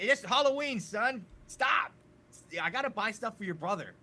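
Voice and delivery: loud, brushing-off father voice; impatient dad. Acted. Father dismissing his son in a loud hurried voice. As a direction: wave the kid away, busy shopping. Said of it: "I was like".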